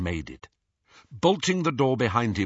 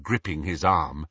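ordinary delivery, nothing marked

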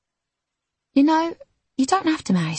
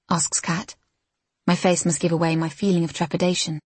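plain reading voice

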